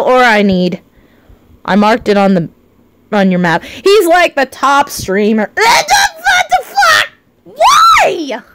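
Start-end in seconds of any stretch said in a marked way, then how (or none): 5.5-8.4 high, drawn-out wail of disbelief; straining voice. Real, not acted. Streamer protesting to the chat, voice straining high. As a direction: cry out till the voice strains. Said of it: "Oh god, my voice".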